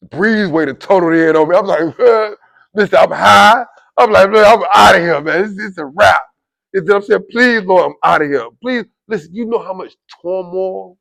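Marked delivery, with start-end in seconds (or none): 2.7-6.2 loud, high outburst; worked-up, shouted alarm; retold panic. 7.3-8.9 loud, urgent plea; raised-voice begging to heaven; pleading prayer. Retold or imitated. Retold panicked prayer.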